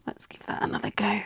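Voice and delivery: soft whisper. Real, not acted. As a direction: whisper it to yourself. Working aside.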